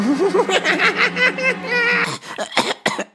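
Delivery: high hammed-up villain cackle; evil villain laugh. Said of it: "mon rire de méchant".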